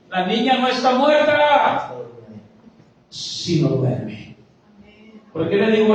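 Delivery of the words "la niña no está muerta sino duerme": slow, drawn-out firm reassurance; calm Jesus. Acted. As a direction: tell them slowly the girl only sleeps. Jesus speaks to the mourners. (Jesús, lento y firme)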